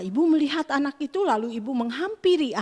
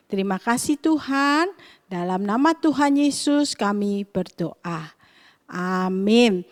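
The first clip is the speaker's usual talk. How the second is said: earnest, measured opening prayer; 4 semitones lower than usual. Acted. Teacher praying before the story, devout and steady.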